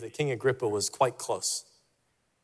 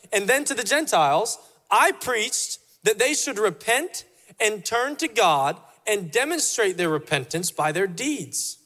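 impassioned scripture reading; 6 semitones higher, 4 dB louder than usual. Acted. Paul pleading his case before the king, preached with rising fervour. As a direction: proclaim Paul's words like a sermon peak. Preacher reads Paul's defence.

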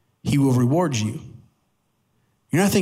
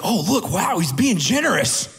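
higher, hammed-up wonder; mock amazement; 3 semitones higher than usual. Left hand noticing the giving, mock amazed. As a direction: play an impressed onlooker gushing. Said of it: "your left hand isn't like".

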